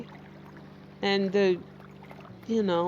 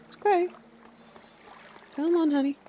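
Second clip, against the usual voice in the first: high, gentle coaxing to a frightened pet; 8 semitones higher, faster than usual. Real, not acted. Coaxing a hurt companion creature, high and gentle. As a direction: soothe the little creature in a high sing-song.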